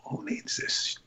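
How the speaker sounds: whispered to yourself in exasperation; hushed aside, almost under the breath; exasperated whispered aside; muttered whisper. Retold or imitated. Retold inner exasperation.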